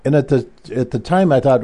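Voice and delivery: ordinary delivery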